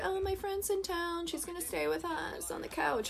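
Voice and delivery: mock roommate voice. Voicing old roommate.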